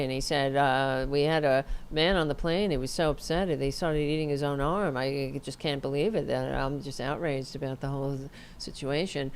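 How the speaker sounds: low, even monotone mimicry; mock deadpan official rambling on; emotionless official imitation. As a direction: drone through it low without any emotion. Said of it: "With no emotion".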